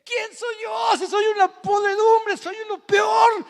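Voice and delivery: high, loud crying plea; retold crying. Preacher voicing the weeping ex-hitman, crying loudly. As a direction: cry out his words through tears. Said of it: "Y decía él llorando".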